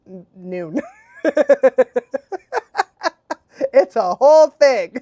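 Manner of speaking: ordinary delivery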